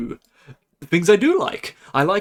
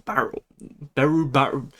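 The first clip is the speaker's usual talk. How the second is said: normal talking voice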